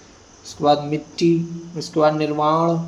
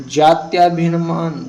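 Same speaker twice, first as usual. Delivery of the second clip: slow, louder, each syllable laid out; slow dictation; 5 dB louder, slower than usual. Shown as a demonstration. Teacher dictating a word to students, slow and louder. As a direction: spell it out slowly for the class. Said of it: "दोनों तरीके से लिखें".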